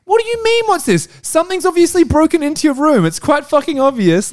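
high-pitched mock outrage; exasperated disbelief. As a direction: scold the clueless character as an incredulous viewer. Acted exasperated viewer answering the character.

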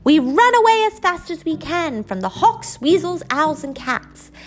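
a small mouse reciting the lesson brightly; little mouse child. Acted. Little mouse answering its mother, eager child voice.

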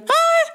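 short high mock scream ah; imitated scream. Retelling the scream heard in the game, mock shriek. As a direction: squeal a brief scream imitation. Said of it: "so ein Schrei".

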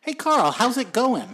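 high, loud hammed-up hello; mock-cheery greeting. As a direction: greet him over the top. Exaggerated greeting to co-host.

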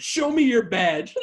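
loud, high, drawn-out challenge; acted-out defiant order; mock demand. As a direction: demand it forcefully, imitating a distrustful mother. Suspicious mother confronting police.

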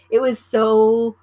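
"so" slow and loud, stretched for emphasis; drawn out so. Real, not acted. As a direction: lean hard on "so". Stressful medical emergency.

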